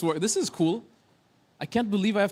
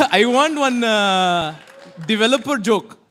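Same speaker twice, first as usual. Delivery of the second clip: laughing shout; 2 semitones higher, 4 dB louder, slower than usual. Real, not acted. Amused shout at AI's reply.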